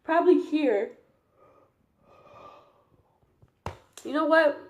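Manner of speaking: talking through a yawn; words stretched by a yawn. Real, not acted. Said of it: "which you could probably hear".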